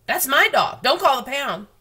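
high, loud, insistent shout; loud protest. A narrator's reading. Girl defends the dog loudly.

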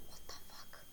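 very soft, voiceless aside; hushed, stunned muttering to yourself; baffled whisper. Real, not acted. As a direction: whisper it in disbelief. Baffled aside at missed spawners.

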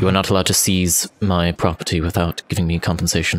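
low, measured formal negotiator; in-character voice. Acted. Character demands payment from wizard, low measured voice. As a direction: lay out terms low and evenly.

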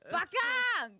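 high-pitched mock anime yell; anime shout. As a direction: hurl it like an outraged anime character. Shouting a well-known anime insult.

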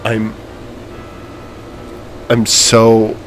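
quiet, tearing-up voice; softly choked up; emotional. Real, not acted. Gamer tearing up after the win, quiet emotional voice. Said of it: "I'm, like, emotional".

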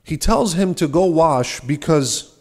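ordinary talk

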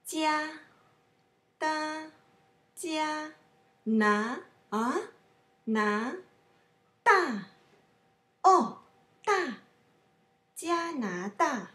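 加 held high, 拿 rising, 大 falling sharply; tone drill. Shown as a demonstration. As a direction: repeat 加拿大 piece by piece with exact tones. Teacher repeats each tone for learners.

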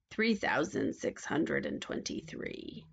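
low, slow, soft witch; witch counting gold. A narrator's reading. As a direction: keep counting slowly in a low voice.

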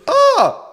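very high, loud "ah" scream; startled scream. Real, not acted. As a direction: shriek at a sudden fall. Falls in the game.

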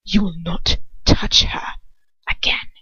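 low, slow quiet threat; quiet fury. Acted. Lucius warns Dolokhov off, quiet slow fury. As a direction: menace him quietly. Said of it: "he says quietly, furiously".

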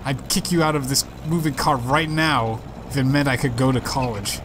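usual speaking voice

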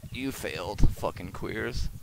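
ordinary delivery

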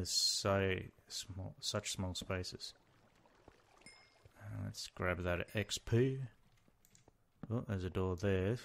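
ordinary delivery

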